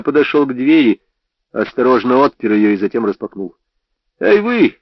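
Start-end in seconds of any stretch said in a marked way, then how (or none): 4.2-4.8 shouted. A narrator's reading. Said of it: "закричал я".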